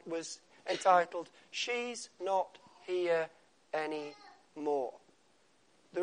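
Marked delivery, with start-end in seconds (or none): 1.5-4.9 slow sad title. Shown as a demonstration. Poem title slowed.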